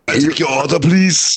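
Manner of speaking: comic action-star impression at a drive-thru; heavy Austrian-accent caricature taking an order; Arnold Schwarzenegger. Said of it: "Arnold Schwarzenegger was working the drive-thru".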